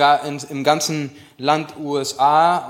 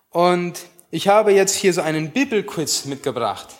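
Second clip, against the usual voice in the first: ordinary talk, nothing marked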